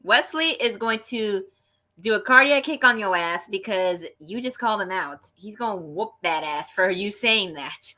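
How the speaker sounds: mock outrage, laying down a warning; indignant warning. Fan warning a trash-talking wrestler, indignant. Acted warning to the name-caller.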